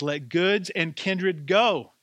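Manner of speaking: rising, fervent hymn lines, slow at the start; hymn line recited. Acted. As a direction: lift the hymn words up with conviction. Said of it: "there's a line that says".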